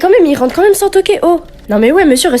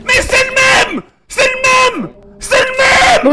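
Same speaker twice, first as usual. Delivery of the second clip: excited shout; 14 semitones higher, slower than usual. Real, not acted. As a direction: shout it out in disbelief. Viewer reacts to creepy face.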